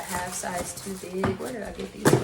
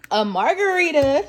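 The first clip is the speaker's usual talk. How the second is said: squealing; 9 semitones higher, 11 dB louder, slower than usual. Real, not acted. Finding drinks in gift bag.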